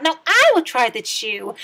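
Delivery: loud eager yell. A narrator's reading. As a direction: call it out, pushing forward. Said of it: "he yelled".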